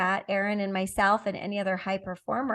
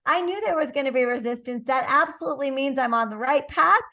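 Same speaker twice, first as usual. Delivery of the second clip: higher, bright, slowing on keep going; upbeat determined tone; 5 semitones higher than usual. Retold or imitated. High performer facing a challenge, determined. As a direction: cheer yourself on past resistance. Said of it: "you're like".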